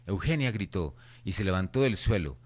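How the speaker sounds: usual speaking voice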